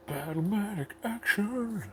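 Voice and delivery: slow, high, booming toy ad voice; commercial announcer. Acted. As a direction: announce Battlematic action like an eighties ad. Announcer take of same words.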